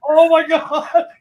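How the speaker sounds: embarrassed laugh. Retold or imitated. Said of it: "I'm like".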